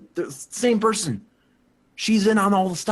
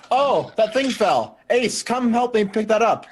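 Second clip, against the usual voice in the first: raised, higher voice calling Ace over; casual call for help, pitched up; 6 semitones higher, 3 dB louder than usual. Acted. Edgar calling Ace over to help, raised voice.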